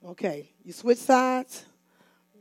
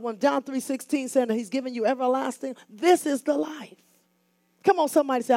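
ordinary talk

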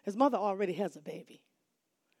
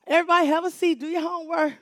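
put-on raised voice telling kids to sit, up high; loud class call; 6 semitones higher, 7 dB louder, faster than usual. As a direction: call the whole room to order. Teacher calling the class to order.

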